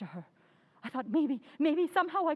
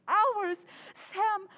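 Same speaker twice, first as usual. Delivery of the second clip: anguished cry; 4 semitones higher than usual. Acted. Wife despairs at long night.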